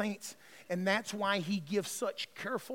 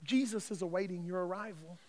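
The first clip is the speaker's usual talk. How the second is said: very soft, gently lowered voice; soft, tender angel voice; 9 dB quieter than usual. Acted. Angels greeting a dying believer, soft and tender. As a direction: speak tenderly, as an angel welcoming a soul. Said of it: "in the most soft and tender voice".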